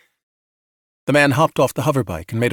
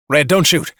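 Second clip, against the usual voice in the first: raised, louder cry to hold fire; urgent plea; 4 semitones higher, 5 dB louder than usual. A narrator's reading. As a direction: call out to stop a shot. Man pleads at gunpoint.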